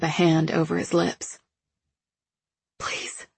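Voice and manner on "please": soft begging whisper. A narrator's reading. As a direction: breathe it out. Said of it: "I whisper".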